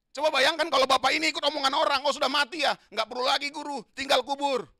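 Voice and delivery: loud, high, rousing sermon voice; preaching at full force. Acted. Preacher imagining the father giving up, loud and high. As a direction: shout it out to the church.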